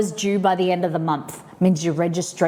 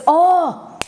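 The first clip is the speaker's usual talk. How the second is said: excited oh; 10 semitones higher, 6 dB louder than usual. Real, not acted. Sudden excited exclamation.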